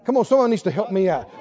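normal talking voice

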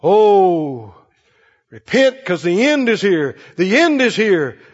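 mock prophesying preacher; doom-preacher tone. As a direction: imitate a preacher warning of the end. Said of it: "you hear that tone in their voice".

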